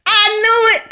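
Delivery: high-pitched retold exclamation; excited outburst. Viewer reliving spotting Calvin on screen, excited outburst. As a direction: burst out with a thrilled, vindicated cry.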